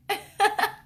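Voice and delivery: short high-pitched laugh; brief amused squeal of laughter; high giggle. Real, not acted. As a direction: let out a quick high giggle. Laughs at her accent remark.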